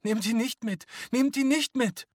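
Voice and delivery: very high, loud desperate plea; screaming. A narrator's reading. Family begging the men not to take him, screaming. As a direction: scream it, rising on the repeat. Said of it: "Angesichts des Geschreis".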